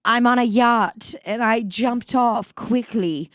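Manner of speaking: put-on affect a theatrical Mid-Atlantic accent. Imagining a castaway off a yacht, mock Mid-Atlantic accent. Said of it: "speaking in this different accent".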